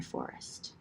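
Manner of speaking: whispered last word; breathy trailing whisper. A narrator's reading. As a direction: let the word fall to a whisper.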